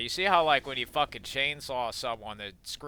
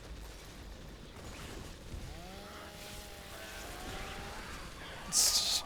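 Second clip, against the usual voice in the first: high wheezing laugh; 9 semitones higher, 9 dB quieter than usual. Real, not acted. Laughing at chainsaw gore.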